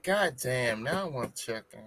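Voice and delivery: low, hushed craving mutter; a soft, hungry aside; quiet craving. Retold or imitated. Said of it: "I'm usually like".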